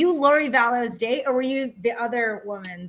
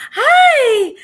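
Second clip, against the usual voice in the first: much higher, louder, laughing sugary greeting; mock chirpy hi; 13 semitones higher, 10 dB louder than usual. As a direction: parody the overly sweet church greeting. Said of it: "people would be like".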